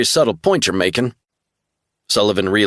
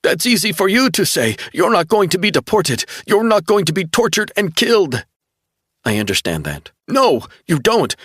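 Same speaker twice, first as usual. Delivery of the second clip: desperate outburst; 7 semitones higher than usual. Acted. Frightened young man pushes back at Caroline, anguished high outburst. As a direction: lash out in panic and grief. Distraught Kevin rejects her comfort.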